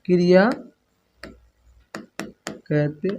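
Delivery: slow dictation, long pauses between words; teacher reading a definition aloud to be copied. Shown as a demonstration. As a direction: spell it out word by word for the class to write down.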